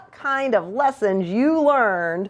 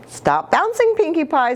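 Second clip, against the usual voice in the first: high mock scolding mother; a hint of a cross mama; 6 semitones higher than usual. A narrator's reading. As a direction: scold the kid to stop bouncing. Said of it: "scolded Mama".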